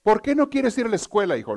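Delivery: high, loud mock mother; mother asking. Joke mother questions her son through the door, exasperated. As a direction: call out the question like an exasperated mom. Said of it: "le dice la mamá".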